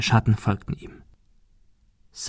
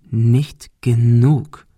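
very slow, deliberate threat; menacing repeat; much slower than usual. A narrator's reading. Bully holds knife to girl's eye, menacing. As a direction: spell out the threat word by word. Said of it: "wiederholte Mike".

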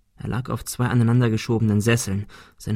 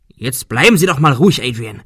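scolding; 3 semitones higher, 6 dB louder than usual. Acted. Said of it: "schimpfte Arthur".

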